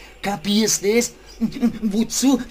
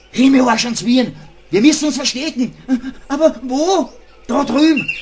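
panicked; 3 semitones higher, 4 dB louder than usual. Acted. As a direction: panic and rush to hide. Animals panic at approaching doctors.

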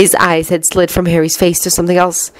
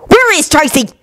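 higher-pitched, angry demand; angry snap; 11 semitones higher than usual. A narrator's reading. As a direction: snap the question at an enemy. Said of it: "he snapped angrily at the werewolf".

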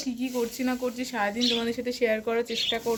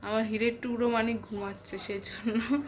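speaking softly; 6 dB quieter than usual. Real, not acted. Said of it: "আস্তে আস্তে কথা বলছি".

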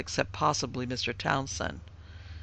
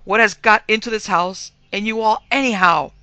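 loud, raised, exasperated demand; emphatic; 6 semitones higher, 10 dB louder than usual. A narrator's reading. Father railing at the whole household, emphatic. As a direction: throw it out hard and high. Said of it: "said he with emphasis".

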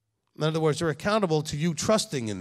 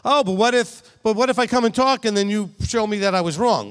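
higher, louder mock complaint; mock whiny objector; 6 semitones higher, 6 dB louder than usual. Rabbi mimicking a congregant afraid to talk, higher and louder. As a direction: play the nervous excuse-maker, lightly.

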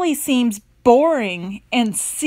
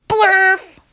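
silly nonsense blurt; 7 semitones higher, 5 dB louder than usual. Shown as a demonstration. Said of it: "even if the words say".

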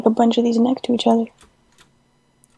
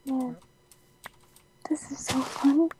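gleeful breathy whisper; 2 semitones higher, 11 dB quieter than usual. Real, not acted. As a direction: whisper it with glee. Whispering gleefully while falling.

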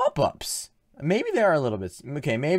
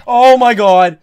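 startled shout; 7 semitones higher, 16 dB louder, slower than usual. Real, not acted. Jump scare.

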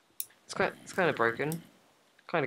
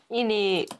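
higher, put-on London slang drawl; rough london slang; 9 semitones higher than usual. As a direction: say it like a London roadman.

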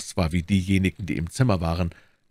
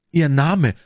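loud, urgent demand; ausgerufen; 7 semitones higher, 7 dB louder than usual. A narrator's reading. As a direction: call it out higher and louder. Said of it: "rief D'Artagnan". (laut und drängend gerufen)

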